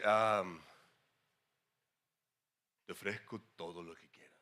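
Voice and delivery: very low, soft tempter's voice; low devil voice. Acted. The devil appears and offers you everything, low devil voice. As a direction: drop right down as the devil making a tempting offer. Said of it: "siempre le ponemos la voz baja".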